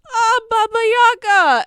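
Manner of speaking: high, loud glad cry; delighted greeting. Acted. The mayor greeting Baba Yaga, loud and delighted. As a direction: greet her like an old friend, loudly. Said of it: "he looks and goes".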